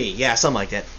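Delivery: ordinary delivery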